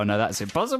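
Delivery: usual speaking voice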